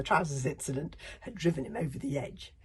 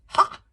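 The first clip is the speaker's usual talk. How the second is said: loud sharp gloating bark; triumphant Ha; 16 dB louder than usual. Acted. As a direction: crow with triumph. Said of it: "cried the librarian triumphantly".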